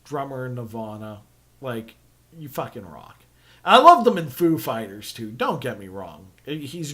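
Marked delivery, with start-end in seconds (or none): 0.1-3.2 low and soft. Retold or imitated. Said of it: "And I was like".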